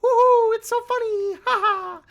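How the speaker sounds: very high, giggly taunting voice; mock the game as a cackling brat; shrill-pitched taunting with laughter. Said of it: "and then the game's like".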